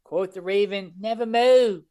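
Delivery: high, loud, hammed-up Baltimore vowels; Baltimore accent. Cohost hamming up a poem line for the show, Baltimore accent. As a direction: proclaim it in broad Baltimore. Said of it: "that Baltimore accent".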